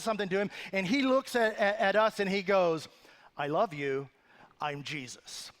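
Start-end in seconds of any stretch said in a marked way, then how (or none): 3.4-5.5 lower, calm, saintly little brother; serene Jesus voice. Retold or imitated. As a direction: play a gentle, pious Jesus. Brother playing Jesus, quoted.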